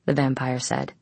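ordinary delivery, nothing marked

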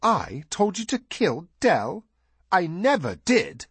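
high, drawn-out indignant disbelief; almost believable show of outrage; feigned outrage. A narrator's reading. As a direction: protest innocence, stretching the words high and slow. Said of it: "His outrage was almost believable".

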